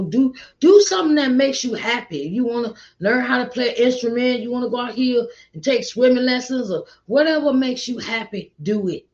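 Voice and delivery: excited urging. Real, not acted. Urging listeners to find joy.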